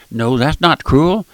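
ordinary talk, nothing marked

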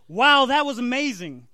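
exclaiming. Acted. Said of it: "Doug exclaimed".

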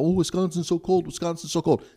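high, whiny mocking tone; parodying outsiders who call Wisconsin cold; mock complaining. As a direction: mimic people who gripe about cold. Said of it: "Whenever anybody talks about".

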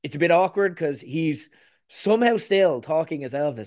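ordinary talk

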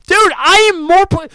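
slow, loud, high mocking wail of the catchphrase; drawn-out yelled taunt at a teammate; mock shout. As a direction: drag it out loud to mock him.

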